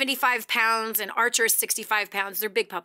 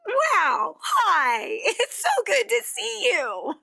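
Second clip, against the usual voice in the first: high, slow, beaming hello to the dogs; delighted greeting; 8 semitones higher, much slower than usual. Retold or imitated. Coming home to tail-wagging puppies, a delighted slow hello. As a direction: gush over them like long-lost friends. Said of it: "and I just went".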